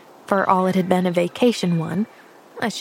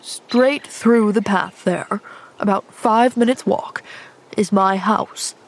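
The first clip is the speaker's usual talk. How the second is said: lightly voiced older man, grudgingly apologetic; a hint of a cranky man calming down; softened gruff man. Injured man apologising to the girl, mildly softened.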